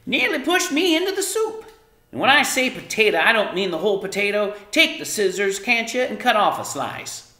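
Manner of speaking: high, loud scolding, rushing in the middle; cross Homily. A narrator's reading. Mother scolding daughter over the potato, high loud cross voice. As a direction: scold her hard and fast. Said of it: "turning angrily".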